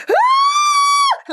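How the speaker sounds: high piercing loud scream; imitated scream. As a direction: let out a sudden shriek of fright. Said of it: "screams, like".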